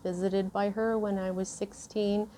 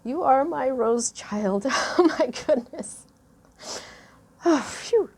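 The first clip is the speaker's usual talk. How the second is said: laughing through tears; 5 semitones higher, 5 dB louder than usual. Real, not acted. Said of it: "tear up here, so pardon me".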